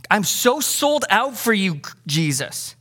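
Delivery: a lightly voiced zealous Peter; passionate Peter. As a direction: swear loyalty with zeal. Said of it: "he's passionate there".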